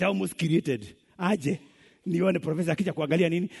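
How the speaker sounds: ordinary delivery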